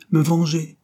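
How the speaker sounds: indignant, sudden outburst; vehement indignation. A narrator's reading. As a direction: fire it out like an arrow. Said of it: "exprimait une indignation véhémente".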